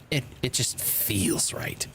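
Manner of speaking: slow, hushed whisper. Acted. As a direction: breathe it out in a whisper. Repeated line, now whispered.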